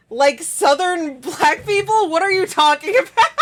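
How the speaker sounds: high, loud laughing confusion; retold baffled question dissolving into giggles; mock bewilderment. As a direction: blurt it incredulously, breaking into laughter. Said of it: "my American brain was just like".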